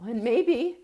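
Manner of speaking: fighting back real tears mid-sentence; tearful. Real, not acted. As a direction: voice rising high as tears come. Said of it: "I don't know why this is making me cry".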